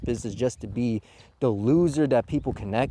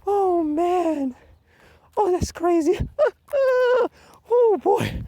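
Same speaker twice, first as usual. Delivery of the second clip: high, squeezed words after a laughing fit; breathless from laughing; slower than usual. Real, not acted. Critic recovering from the goldfish joke, breathless with laughter. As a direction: wind down from hysterical laughter. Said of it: "I gotta catch my breath".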